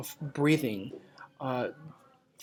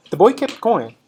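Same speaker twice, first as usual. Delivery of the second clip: story narration; 2 semitones higher, 6 dB louder than usual. Acted. Reads story narration to class.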